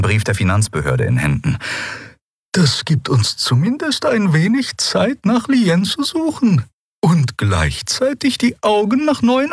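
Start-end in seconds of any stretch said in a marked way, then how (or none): 2.5-9.5 gentle. A narrator's reading. Draxyl reads the letter to the quarrelers, gently. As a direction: take your time, high and soothing. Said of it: "sagte die Draxyl sanft".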